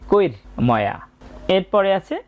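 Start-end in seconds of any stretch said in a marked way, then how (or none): 0.1-1.0 "কৈর ময়া" with a short r before m; half-r cluster. Shown as a demonstration. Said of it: "তার আগে অর্ধেক র হবে".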